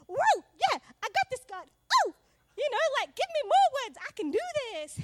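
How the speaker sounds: high, loud triumphant cheer; pumped up. Retold or imitated. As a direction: shout it like you just won. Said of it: "And I was like".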